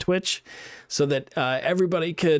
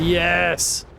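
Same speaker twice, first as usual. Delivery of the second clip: triumphant yes; 4 semitones higher than usual. Real, not acted. Landing the jump.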